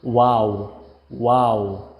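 "ওয়াও" drawn out as an exclaimed wow; wow as they say it. Retold or imitated. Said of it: "তারা বলে থাকে".

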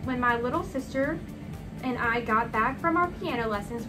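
ordinary delivery

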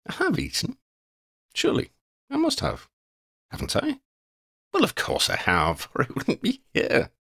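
high, doubtful vagabond voice settling into certainty; scatterbrained wanderer. Acted. Quinn unsure whether he has eaten, scatterbrained. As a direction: doubt yourself aloud, then reassure yourself. Wanderer reasons about eating.

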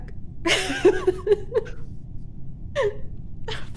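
breathless high laughing fit; long laugh. Real, not acted. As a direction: crack up helplessly. Reaction to Kevin silence joke.

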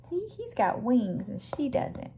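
ordinary delivery

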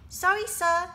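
put-on sheepish boy. A narrator's reading. Boy apologizes to headmaster.

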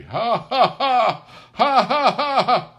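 high, loud lusty laughing; roaring laughter. A narrator's reading. Friends at Christmas dinner roaring with laughter. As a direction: roar with laughter together. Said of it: "roared out lustily".

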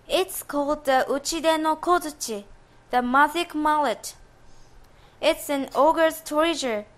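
put-on gentle young lady. Daughter's voice.

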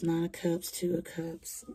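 usual speaking voice

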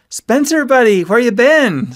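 loud, high call to a buddy; shout. A narrator's reading. Friend plopping down on the couch, shouting a greeting. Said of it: "Ski shouted".

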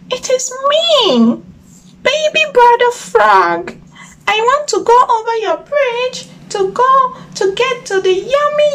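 very high little frog voice; baby frog pleading for yummy bugs. Acted. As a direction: squeak it like a tiny hungry frog.

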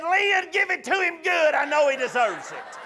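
high, imitated shout from a passerby; woman calling out. Woman calling to father from her window, woman calling out. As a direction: call it out high, egging him on. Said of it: "rolled down her window and said".

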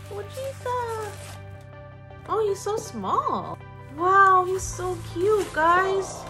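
high, drawn-out cooing squeal; high-pitched, delighted fawning; gushing. Real, not acted. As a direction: gush over something tiny and adorable. Gushing over the unboxed figure.

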